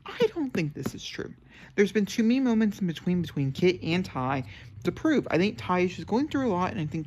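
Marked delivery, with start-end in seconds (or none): 0.0-1.3 firm. Real, not acted. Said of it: "I'm gonna be very firm here".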